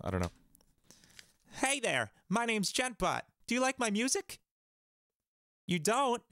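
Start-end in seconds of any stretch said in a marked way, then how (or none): 1.5-6.2 much higher, sing-song bot persona; silly chatbot voice. Acted. Playing a chatbot asking if viewers like his music. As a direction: play a goofy music bot. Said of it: "say some stupid stuff".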